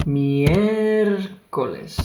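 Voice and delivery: Miércoles slow with the stress held hard on the mié; exaggerated stress. Shown as a demonstration. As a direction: lean heavily on the mié of Miércoles, slowly. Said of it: "on the E".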